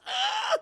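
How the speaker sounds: very high, loud acted scream; mock yelp of pain; sudden high-pitched cry; short shriek. Acts cry as hand breaks.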